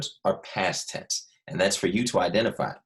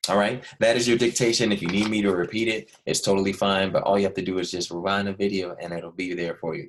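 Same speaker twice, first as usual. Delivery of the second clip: ordinary delivery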